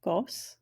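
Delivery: gos whispered with a short o; soft whispered gos; whispered model word. Shown as a demonstration. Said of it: "That's a borrowed word".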